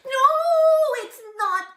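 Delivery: high squeaky little goblin voice; tiny goblin. Acted. Tiny goblin catches Herschel lighting candles, high squeak. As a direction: squeak it out like a horsefly-sized goblin.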